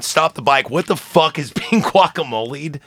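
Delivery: ordinary delivery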